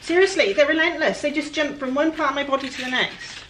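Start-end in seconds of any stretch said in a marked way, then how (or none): 0.0-2.4 high, loud, fed-up complaint; exasperated outburst. Real, not acted. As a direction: burst out at the pesky flies. Annoyed by biting flies.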